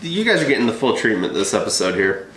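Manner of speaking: ordinary talk, nothing marked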